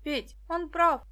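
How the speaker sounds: put-on girl calling out to stop him; shouting. A narrator's reading. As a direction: shout urgently to Petka. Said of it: "крикнула Даша".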